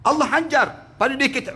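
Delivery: ordinary delivery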